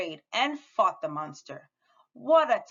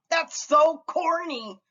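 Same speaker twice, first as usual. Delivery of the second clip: mocking scoff; 8 semitones higher, 4 dB louder than usual. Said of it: "Nelson snorted".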